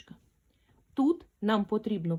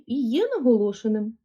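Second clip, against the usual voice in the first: ordinary talk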